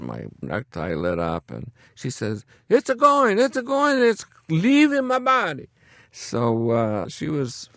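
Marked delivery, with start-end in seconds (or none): 2.7-5.7 put-on high, loud, elated Italian accent. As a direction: cry it out like the relieved patient. Patient's cry when the tie lights.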